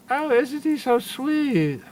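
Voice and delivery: high, slow mock coo of a doting woman. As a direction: imitate women melting over a cute cat. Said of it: "women cooing over cats".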